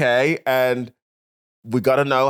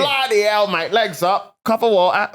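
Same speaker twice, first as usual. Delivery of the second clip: higher, punchy hammed-up Cockney; mock Cockney accent; 7 semitones higher, 4 dB louder, slower than usual. Riffing on the bottle-of-water workmate, mock Cockney. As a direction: belt out short comic Cockney exclamations. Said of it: "It was like a Cockney".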